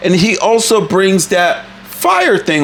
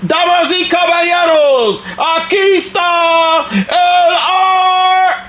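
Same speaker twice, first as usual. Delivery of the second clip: much higher, slow, booming ring-announcer shout in Spanish; lucha libre announcer; 11 semitones higher, slower than usual. Pretend. Vlogger introducing the clown like a Mexican wrestling ring announcer, booming. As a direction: stretch every word like a wrestling MC calling the champ. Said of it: "if I did this lucha libre style".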